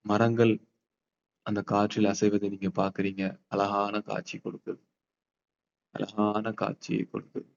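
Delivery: slow, soft meditation voice; guided meditation. Acted. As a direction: paint the calm scene slowly. Guided visualization in park.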